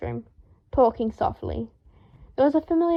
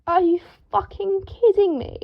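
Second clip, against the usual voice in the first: furious; 8 semitones higher than usual. A narrator's reading. Draco furious at the torn robes. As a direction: fume at the ruined silk. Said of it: "Draco said furiously".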